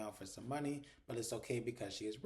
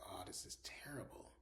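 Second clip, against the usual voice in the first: hushed, whispered dismay; appalled whisper; 4 semitones lower, 12 dB quieter than usual. Retold or imitated. Viewer reacts to a man's dentures falling out, appalled whisper. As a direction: whisper it low, as a pained aside. Own embarrassed reaction, retold.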